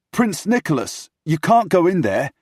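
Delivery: high, loud, panicky call; alarmed protest. Acted. Secretary blocking the Queen's door, alarmed protest. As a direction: cry out to stop him. Secretary tries to stop prince.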